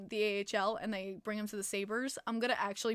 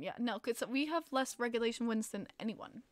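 ordinary talk, nothing marked

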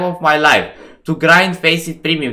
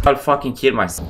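ordinary delivery

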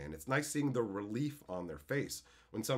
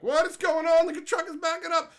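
much higher, loud, confused panic; mock panic in a McDonald's; startled alarm; 10 dB louder than usual. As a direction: react as if a truck is reversing. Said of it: "I'm like".

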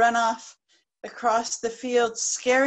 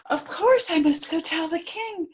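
flustered hen; 7 semitones higher, 4 dB louder than usual. Acted.

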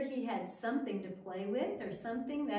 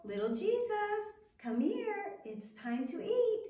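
put-on higher sing-song call to a child; a mother's higher calling voice; 7 semitones higher than usual. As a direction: call little Jesus in to eat like a mother. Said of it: "And she would call".

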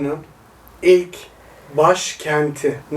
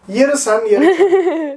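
high squealing laughing tease; 8 semitones higher, 3 dB louder, much slower than usual. Real, not acted. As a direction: giggle it out teasingly. Teasing while laughing.

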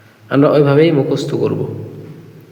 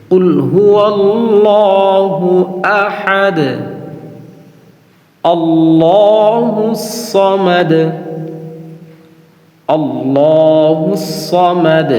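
slow sung recitation, higher pitch; Quran recitation; 5 semitones higher, much slower than usual. Shown as a demonstration. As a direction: chant it reverently with long held vowels. Recites full surah.

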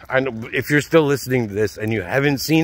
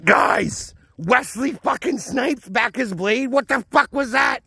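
excited yell, much higher; 12 semitones higher, slower than usual. Real, not acted. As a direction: shout it out in disbelief. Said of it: "I just yelled that".

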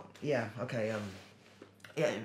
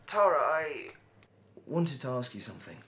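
nervous; 3 semitones higher, 10 dB louder than usual. Acted. Man working up to asking Tara something, nervous. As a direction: blurt the name, then settle into asking. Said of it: "Why do you sound so nervous".

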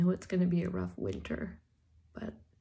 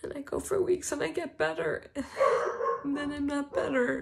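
crying through laughter; 8 semitones higher, 3 dB louder than usual. Real, not acted. Tearful vlog about long covid.

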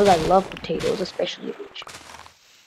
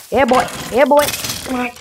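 excited, rising cheer; 5 semitones higher than usual. Real, not acted. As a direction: whoop it out, pumped. Cheering a skeleton's death.

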